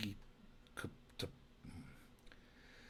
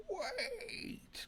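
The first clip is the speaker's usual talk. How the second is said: cartoon cry; 7 dB quieter than usual. Duckman interrupts the booing crowd.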